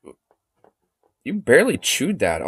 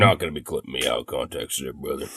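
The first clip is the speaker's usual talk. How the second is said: much lower put-on deep voice; deep voice demo, much lower than usual; 13 semitones lower, 11 dB quieter than usual. Shown as a demonstration. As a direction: drop way down into a deep character voice. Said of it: "I'll do a deep voice just in general".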